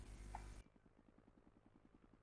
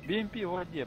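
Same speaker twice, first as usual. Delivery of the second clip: higher, louder burst of surprise; startled callout; 7 semitones higher, 5 dB louder than usual. Real, not acted. Player reporting a BMP in the water to teammates, startled. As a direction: blurt it out in disbelief, pitch jumping up.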